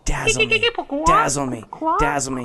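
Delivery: high, sing-song dazzle me; fairy voice. Retold or imitated. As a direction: coax it out like a fairy. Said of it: "I'm just gonna say".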